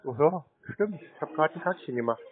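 ordinary talk, nothing marked

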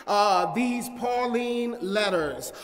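loud, rising preacher's cadence; preaching at full force. Acted. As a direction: build up the congregation. Sermon builds.

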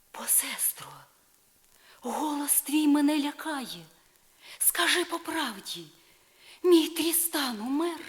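rising alarm as she begs for the truth; frightened plea. Acted. Isolde the Fair begs her sister for the truth, frightened. Isolde fears Tristan is dead.

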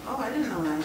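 ordinary talk, nothing marked

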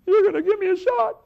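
high, loud panicked squeal; panicked child. Retold or imitated. Boy squeals in fear at the dentist's needle, panicked high voice. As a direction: squeal it like a terrified little boy.

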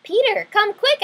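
high, loud, drawn-out call; excited shout for Peter. Acted. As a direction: call your brother from across the house. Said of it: "I shouted".

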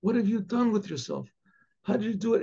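high, soft, pained questioning; lightly acted judge gently asking an old friend; judge's appeal. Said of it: "She said".

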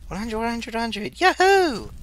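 high, rising cheer; high whooping delight; excited cheer. Real, not acted. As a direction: celebrate the number with a whoop. Thrilled at a hundred units.

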